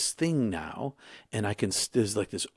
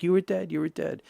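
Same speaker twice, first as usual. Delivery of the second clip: higher, louder, insistent; blurting it out in shock; urgent exclaiming; 10 semitones higher, 5 dB louder than usual. Retold or imitated. Friend exclaims after his revival.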